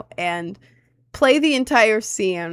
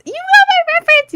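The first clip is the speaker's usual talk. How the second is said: very high, loud and fast squeal; squeal of delight; 10 dB louder, faster than usual. Real, not acted. Thrilled squeal at a viewer getting her reference. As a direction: shriek with joy that chat caught it.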